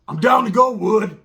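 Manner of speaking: high, loud declaration; imitating a fired-up little biker; retold eager volunteer. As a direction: call it out raring to go.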